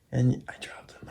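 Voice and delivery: soft whispered self-reproach; whispering. Real, not acted. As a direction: breathe it out under your breath. Self-reproach whispered over broken toy.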